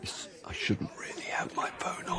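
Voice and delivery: hushed library whisper; whispering into the phone. Pretend. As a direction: keep it down, you're in a library. Pretends to be in library.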